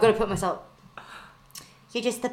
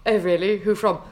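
ordinary delivery

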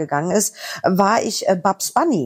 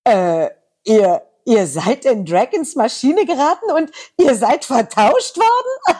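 high, slow, squeaky cartoon voice rising to a squeal; slow baffled cartoon squeak; cartoon character voice; 5 semitones higher, 3 dB louder, slower than usual. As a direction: play the baffled cartoon sidekick.

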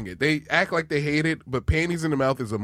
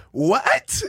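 much higher, squeaky puzzled "What?"; imitating Joe DeRosa; 11 semitones higher than usual. Mocking Joe DeRosa's reaction, high squeak. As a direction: yelp it like a confused muppet. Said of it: "Joe DeRosa goes".

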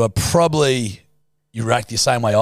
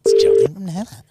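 bubbly radio voice; 6 semitones higher, 3 dB louder than usual. Pretend. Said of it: "On a bubbly voice like they do in radio".

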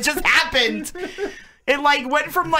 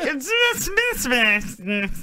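garbled mumble; slower than usual. Retold or imitated. Host imitating what she heard from caller, garbled mumble. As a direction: mumble nonsense syllables like an unintelligible caller. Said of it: "what I heard".

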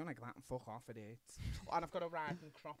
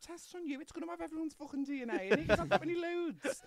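imagined warning; 10 semitones higher, 12 dB louder than usual. Retold or imitated. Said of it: "I'm thinking".

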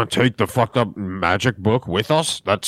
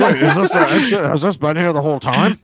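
loud, high laughing disbelief; surprised laughing; 6 semitones higher, 4 dB louder than usual. Real, not acted. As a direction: shout it through a laugh. Surprised discovery of exit.

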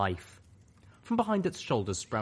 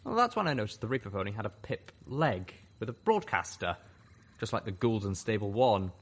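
ordinary delivery

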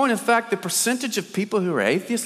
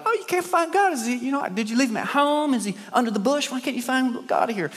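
higher-pitched, teasing villagers; amused mockery; 7 semitones higher than usual. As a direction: taunt him with mock-innocent questions. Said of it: "they're amused by the whole thing".

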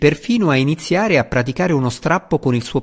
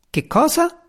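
much higher, squeaky sprite voice; silly squeaky exclamation. A narrator's reading. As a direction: blurt it like a dim little sprite. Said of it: "esclamò Tefanis con aria sciocca".

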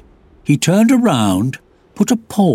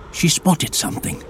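usual speaking voice

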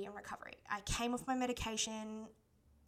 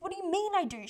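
higher and louder, shocked disbelief; 10 semitones higher, 8 dB louder than usual. Retold or imitated. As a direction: blurt it out, thrown by the news. Sister confirms the diagnosis.

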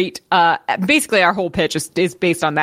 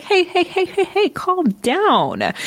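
higher, slow hey hey hey, calm down; mock soothing boss; 10 semitones higher, slower than usual. Pigeon boss Zeus calming Eartha down, high and slow. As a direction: ease her off like a smug boss.

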